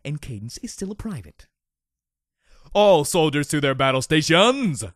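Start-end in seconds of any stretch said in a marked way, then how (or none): 2.7-4.8 loud battle-station command; shouted order. A narrator's reading. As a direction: call the troops to arms. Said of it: "I ordered".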